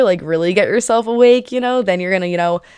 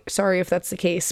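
normal talking voice

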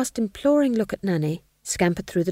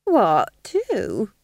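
lightly voiced witch, higher; Nanny's character voice; 4 semitones higher, 4 dB louder than usual. A narrator's reading. Witch questioning her interrogator, lightly voiced role. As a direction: answer innocently, pitched up. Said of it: "said Nanny".

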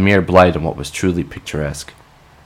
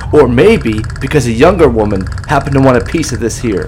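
manic rant; 8 semitones higher, 6 dB louder than usual. Acted. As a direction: rant like he's lost it. Manic defiant rant at Monica.

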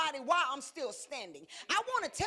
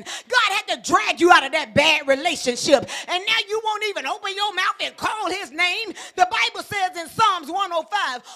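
loud, fervent sermon climax; full-force preaching; 3 semitones higher, 13 dB louder than usual. Acted. Preacher drives the congregation to call God's name, shouting. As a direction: preach it with fire to the whole room.

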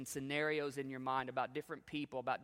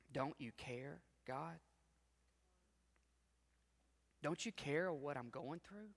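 pleading quietly; 2 semitones higher, 5 dB quieter than usual. Retold or imitated. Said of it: "out of frustration".

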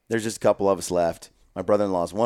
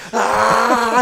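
much higher, loud charging yell; battle yell; 13 semitones higher, 8 dB louder than usual. Retold or imitated. Brother-in-law charging the other team, loud yell. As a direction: let out a wild mock war cry. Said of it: "and he goes".

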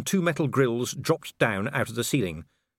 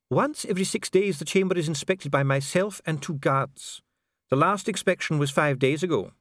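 higher-pitched, formal official; precise official Dee; 8 semitones higher than usual. Acted. Official explains the mechanism to visitor, formal.

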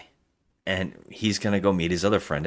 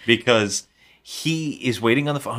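ordinary delivery

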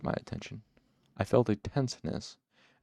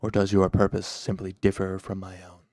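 cool, careful challenge, firmly louder; careful, pointed; 5 dB louder than usual. A narrator's reading. Dark man questions Cinder's purpose, carefully and pointedly. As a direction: place the words carefully, as if weighted. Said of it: "The last words were spoken carefully".

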